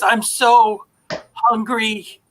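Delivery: starving feral son. Acted.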